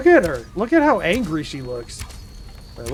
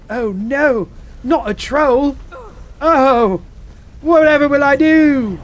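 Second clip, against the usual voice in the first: high, loud damsel-in-distress act; hammed-up helpless despair; mock dread; 8 semitones higher, 5 dB louder, slower than usual. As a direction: feign panic, building to a drawn-out wail. Mock dread at meeting a troll.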